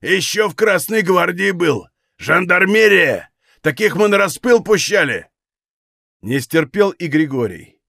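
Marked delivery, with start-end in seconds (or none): 0.0-5.3 high-pitched, angry parting shot; stinging jibe. Acted. Валет at the door, flinging an angry jibe at Григорий. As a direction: hurl a bitter accusation. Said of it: "кольнул улыбавшегося зло Григория".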